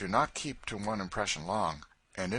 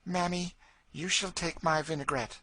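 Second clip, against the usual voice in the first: put-on light high girl's voice; a sweet, eager child; small girl offering a gift, high and earnest; little Eva; 11 semitones higher, 3 dB louder than usual. A narrator's reading.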